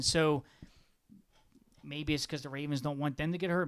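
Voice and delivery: usual speaking voice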